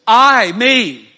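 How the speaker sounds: booming, emphatic stress on "I" and "me". Shown as a demonstration. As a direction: hit "I" hard and long, then "me" just as forcefully. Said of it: "it reads like this".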